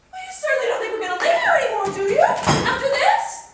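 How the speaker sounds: high, loud, rapid outrage; shrill-pitched marital outburst; indignant shouting. Acted. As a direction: fire it off loudly in disbelief. Wife shouting in quarrel.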